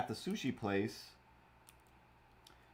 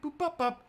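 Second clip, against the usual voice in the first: high beeping noises. Retold or imitated. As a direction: mouth phone-dial beeps. Imitating phone dial beeps.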